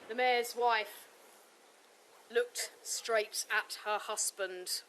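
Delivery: ordinary delivery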